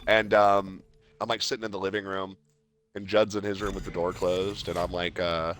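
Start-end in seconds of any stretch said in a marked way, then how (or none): none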